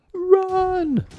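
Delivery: very high, very loud scream to flee; yelled warning. Acted. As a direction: scream at everyone to run. Fleeing the clown.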